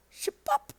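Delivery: high squeaky slurp sound; slurping noise. Pretend. Reading the comic's slurp noise, squeaky and high. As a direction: read the slurp like a cartoon effect.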